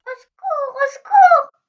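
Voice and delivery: high, rising desperate call for help; cry for help. Acted. Trapped little girl calls for help. (appel au secours aigu et paniqué)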